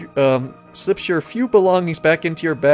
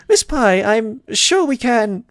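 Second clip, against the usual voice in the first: higher, measured mayor voice; slow down and placate, pitched up; 7 semitones higher, slower than usual. Acted. Mayor trying to calm Pinkie, placating.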